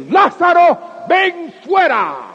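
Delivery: high, loud, slow shout of Lázaro, ven fuera; loud call. Acted. Jesus calling Lazarus out of the tomb, loud slow shout. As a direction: call the dead man out with full force. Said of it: "lo levantó fuerte, que habló con fuerza".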